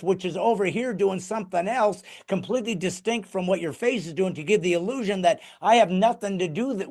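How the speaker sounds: ordinary talk, nothing marked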